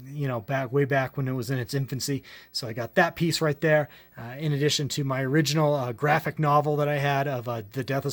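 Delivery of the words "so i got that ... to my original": ordinary delivery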